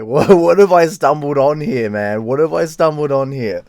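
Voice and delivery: half-amused, incredulous outburst; acted bewilderment. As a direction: replay your baffled reaction with a big punch. Acts out his bewildered reaction.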